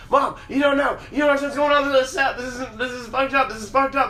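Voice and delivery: high, loud, fast pleading at mom; yelling at mom that she doesn't understand; retold shout. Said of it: "I'm like".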